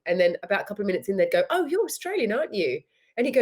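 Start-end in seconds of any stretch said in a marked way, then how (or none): 1.5-2.8 high, lilting stranger's exclamation; imitating surprised partygoers. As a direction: mimic strangers realizing where someone is from. Strangers' party reaction, acted out.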